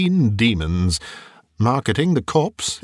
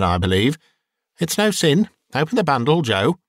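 brazen charwoman; 3 semitones higher than usual. Acted. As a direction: push old Joe to name a price. Bold woman demands Joe's appraisal.

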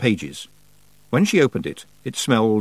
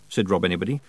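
plain reading voice

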